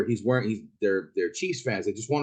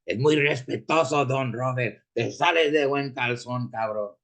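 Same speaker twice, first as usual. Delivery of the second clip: homie's Mexican slang voice. Retold or imitated. Driver mocks upset Chiefs fans in a Mexican Spanish homie voice. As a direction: needle him in a Mexican Spanish homie voice, don't cry, it's just a game. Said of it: "Is the way they used to talk to me".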